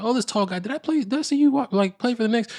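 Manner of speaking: high, unsure passerby voice; starstruck fan. Retold or imitated. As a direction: play a fan half recognising him.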